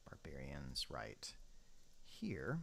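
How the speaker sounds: unvoiced whisper to himself. Shown as a demonstration. Gamer placing barbarians, whispering to himself. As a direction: whisper it under your breath.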